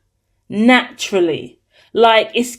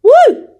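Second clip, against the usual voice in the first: excited whoop; 8 dB louder than usual. Real, not acted. Excited cheer after reveal.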